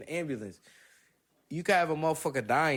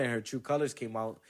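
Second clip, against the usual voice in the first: ordinary delivery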